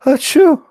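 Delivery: high, loud hammed-up sneeze; loud fake sneeze. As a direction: sneeze big and theatrical. Fake sneeze after sneeze hadith.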